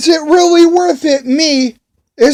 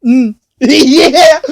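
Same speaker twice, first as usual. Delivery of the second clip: real laugh. Real, not acted. Laughs at his own story.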